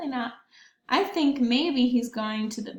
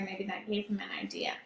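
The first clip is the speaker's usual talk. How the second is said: whisper; 4 semitones lower, 10 dB quieter, much faster than usual. Real, not acted. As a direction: whisper it like a secret. Teacher aside to children.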